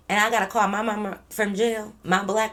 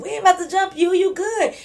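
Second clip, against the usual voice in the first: pitched way up, coaxing her to come outside; high reassuring plea; 10 semitones higher, 4 dB louder than usual. Retold or imitated. Narrator reassuring Carmen she won't get jumped, high coaxing voice. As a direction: promise her nobody's jumping her, high and eager.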